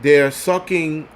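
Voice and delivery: usual speaking voice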